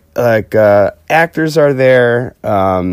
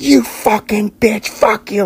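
higher, mock furious cussing; 9 semitones higher than usual. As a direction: spit out a retold tirade. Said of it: "cussing her out".

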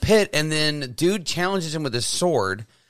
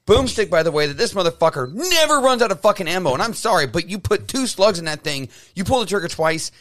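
comic rant over tiny details, building higher; worked-up fan complaint that climbs to a peak; flipping out; 4 semitones higher than usual. Real, not acted. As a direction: nitpick indignantly, rising to an outburst. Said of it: "I'm gonna flip out on, on tiny details".

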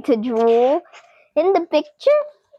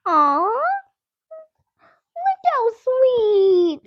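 aww; 9 semitones higher, slower than usual. Real, not acted. Adores the picture.

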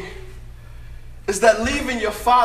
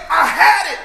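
proud declaration; 4 semitones higher, 4 dB louder than usual. Real, not acted. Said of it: "everything that what I know you guys think is great".

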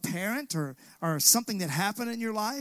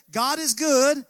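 put-on a Southern old-timer call, raised and louder; lightly voiced Mississippi elder, higher and louder; 3 semitones higher, 4 dB louder than usual. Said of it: "The old timers in Mississippi would say it like this".

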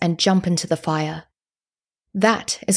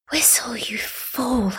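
slow breathy whisper to herself; inner whisper; 5 semitones higher, 4 dB quieter, slower than usual. A narrator's reading. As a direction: whisper it slowly, scolding yourself. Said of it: "I thought to myself".

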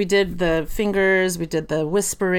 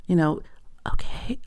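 whispering; 3 dB quieter than usual. Shown as a demonstration. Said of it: "it's like this".

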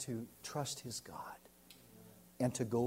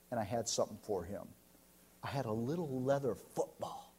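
usual speaking voice

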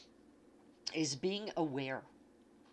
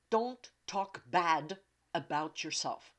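ordinary talk

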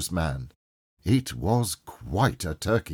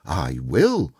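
low start with a big swooping emphasis; heartfelt cry; 6 semitones lower, 4 dB louder than usual. A narrator's reading. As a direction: promise it emphatically. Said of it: "cried the old gentleman".

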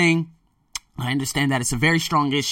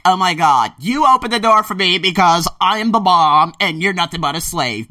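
lightly mocking a haughty woman, louder and higher; stuck-up woman; 6 semitones higher, 6 dB louder than usual. Mocking snobby club women who expect doors held, haughty. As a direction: sneer it like you're above everyone. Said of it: "You got those women who are like".

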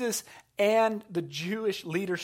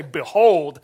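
mock child proclaiming; 13 dB louder than usual. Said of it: "a five-year-old who say".

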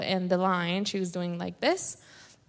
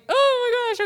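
much higher, louder startled cry; startled gasp; 5 dB louder than usual. Retold or imitated. Caught by a friend before the tunnel, startled squeal. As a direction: yelp it out, caught off guard. Startled reaction to being called.

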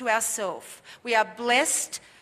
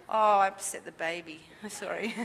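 laughing apology; 3 semitones lower than usual. Real, not acted. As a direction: apologize through a real chuckle. Laughing apology after startling a baby.

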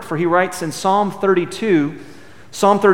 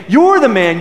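louder, pointed accusation; upset accusation; 7 semitones higher, 8 dB louder than usual. Retold or imitated. Prophet Nathan accusing King David, louder and pointed. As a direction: pin the blame squarely on him. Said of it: "He is very upset".

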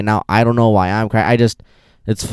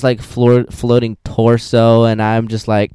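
normal talking voice

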